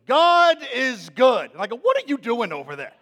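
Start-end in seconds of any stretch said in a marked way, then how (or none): 0.1-1.5 put-on high, loud, slow child voice; drawn out like a kid parroting a saying; imitating a little girl's slow pronouncement; daughter's voice. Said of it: "she goes". 1.8-2.9 baffled dad. Retold or imitated. Dad reacting to daughter.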